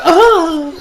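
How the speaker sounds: high, loud sudden yelp; startled shriek. Retold or imitated. Woman yelps when her hand is licked, high startled shriek. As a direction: shriek in shock at the lick. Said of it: "She's like".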